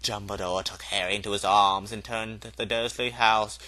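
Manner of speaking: plain reading voice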